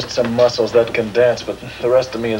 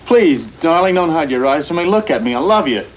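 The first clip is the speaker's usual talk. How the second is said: pleading; 8 semitones higher, 4 dB louder than usual. Acted. Husband pleading with trembling wife.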